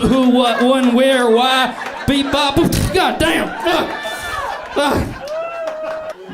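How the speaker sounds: very high, escalating comic frustration; flustered floundering. Acted. Quizzed man failing the pronoun test, high flustered voice.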